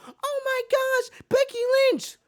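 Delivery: mock her like a gushing little kid; mock kid fangirl. Mocking Bianca as a starstruck kid. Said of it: "Don't act like a kid over here like".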